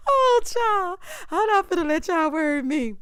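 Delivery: high voice coming down from a laugh; amused, laughing. Real, not acted. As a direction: recover from cracking up, pitched high. Recovering from own laughing fit.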